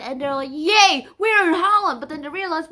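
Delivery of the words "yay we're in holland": high, loud mock celebration; excited cheer. Separatists celebrating arrival in Holland, excited cheer. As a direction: cheer like a happy crowd.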